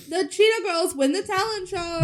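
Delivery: high-pitched mock announcement; announcer. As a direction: call the winner with a stretched ending. Voicing the announcement.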